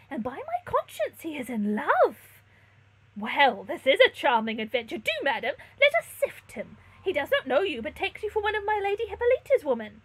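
high, loud conspiratorial delight; eager scheming servant; excited maid. A narrator's reading. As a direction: gossip with theatrical relish. Maid gossips about the stranger.

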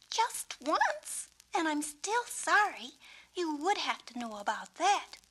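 embarrassed confession. Acted.

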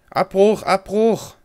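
high, loud burst, repeated; alarmed call to abort. Real, not acted. Player panicking over a wrong key, urgent loud call.

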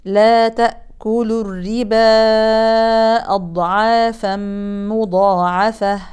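الربا with its alif drawn out long before أضعافا; long madd demo. Shown as a demonstration. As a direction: stretch the alif of الربا six counts. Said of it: "ومد الألف مدا طويلا". (مد منفصل طويل)

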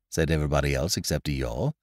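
ordinary delivery

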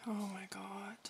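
barely audible, voiceless whisper; frightened whisper. Real, not acted. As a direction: whisper it in dread. Tense moment in scary game.

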